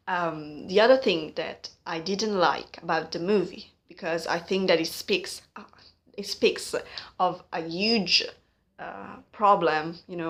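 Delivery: usual speaking voice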